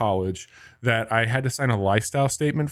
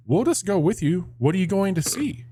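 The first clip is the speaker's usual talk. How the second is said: cheery professor; 8 semitones higher than usual. Retold or imitated. Professor voiced in retold story.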